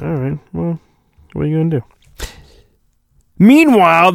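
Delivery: normal talking voice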